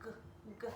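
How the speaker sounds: short G, g from the throat. Shown as a demonstration. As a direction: click the g. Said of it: "that G sound is gonna be in my throat".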